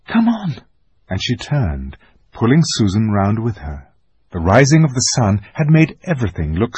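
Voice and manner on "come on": much higher urgent call. A narrator's reading. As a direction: pull her sister along. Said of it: "said Lucy".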